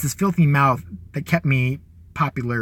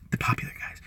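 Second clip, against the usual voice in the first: breathy unvoiced aside; whisper; 11 dB quieter than usual. Mocking the popular kids to the audience, in a whisper. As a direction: hush it like a secret jab.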